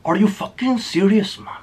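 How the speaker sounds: mild mock indignation at a betrayal; mock outraged boss. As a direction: lightly play the offended boss. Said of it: "He said".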